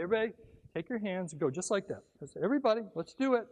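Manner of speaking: loud rallying call to the crowd. Real, not acted. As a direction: pump up the audience. Calling audience to participate.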